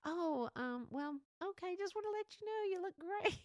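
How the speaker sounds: smooth over the blunder nervously; awkward cover-up. Retold or imitated. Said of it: "I was like".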